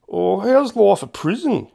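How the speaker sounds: high, loud, slow puzzled question; venturing a question. Acted. Hamish questioning the odd missionaries, venturing puzzlement. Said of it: "he ventured".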